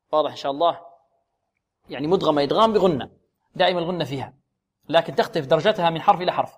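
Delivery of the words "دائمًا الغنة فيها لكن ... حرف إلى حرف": ordinary talk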